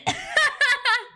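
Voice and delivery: high-pitched burst of laughter; high giggle. Real, not acted. Laughing at the bleh.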